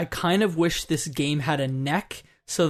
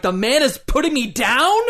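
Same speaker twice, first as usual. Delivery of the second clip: indignant mock protest, higher-pitched; mad, retold; 7 semitones higher, 4 dB louder than usual. Player retelling being mad at the game, mock-indignant outcry. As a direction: rise into an outraged question, hammed up. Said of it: "I was so mad".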